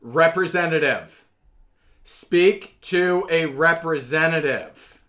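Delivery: loud, slow, insistent command to a machine; repeated command. Retold or imitated. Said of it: "it's always like".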